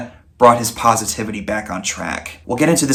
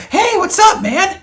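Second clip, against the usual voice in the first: high-pitched, delighted greeting; acted-out happy hello, pitched high; greeting an old friend; 4 dB louder, slower than usual. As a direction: call out to a long-lost buddy, voice leaping way up. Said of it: "feels like an old friend where you go".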